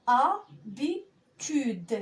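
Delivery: Habitude stretched out syllable by syllable, very slow; drawn-out syllables. Shown as a demonstration. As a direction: sound out Habitude very slowly, syllable by syllable. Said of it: "إذا أجمع الأجزاء س-، د-، د-، وأنطقها بمرة واحدة".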